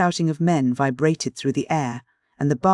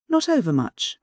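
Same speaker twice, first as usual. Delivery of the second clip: Charlotte, higher; 6 semitones higher than usual. A narrator's reading. Charlotte's reply.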